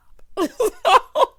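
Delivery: loud, breathy laugh; short high wheezing cackle; burst of cackling. Real, not acted. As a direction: explode laughing after the whispered line. Cracks up after whisper.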